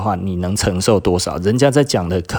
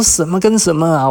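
incredulous outburst; 9 semitones higher, 4 dB louder than usual. Retold or imitated. Reacting to the classmate's jab.

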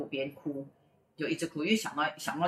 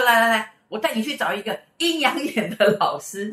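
higher, loud, insistent; acted urging; 6 semitones higher, 7 dB louder than usual. Classmate taking him to another master, loud acted urging. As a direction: urge him along forcefully. Classmate urgently drags friend along.

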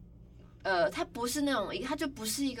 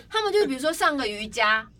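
ordinary talk, nothing marked

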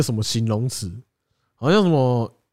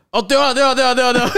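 mock eager agreement; 8 semitones higher, 3 dB louder, faster than usual.

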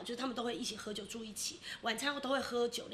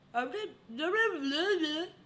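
slow, slurred, higher and louder mumbling; stroke mumbling; 5 semitones higher, 4 dB louder, much slower than usual. Retold or imitated. Dad mid-stroke refusing milk, slurred slow mumble. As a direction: garble the words like a tongue that won't move. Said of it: "我爸就突然这样".

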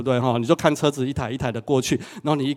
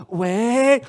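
lovesick hello; 9 semitones higher, 3 dB louder than usual. Retold or imitated. Acted lovestruck phone greeting.

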